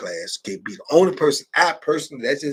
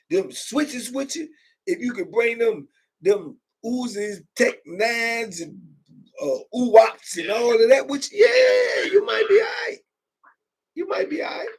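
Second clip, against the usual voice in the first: mock excited; 9 semitones higher than usual. Real, not acted. Mock excitement about bringing guns.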